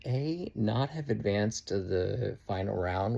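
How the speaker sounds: normal talking voice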